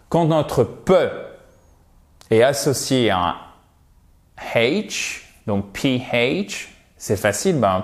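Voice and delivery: normal talking voice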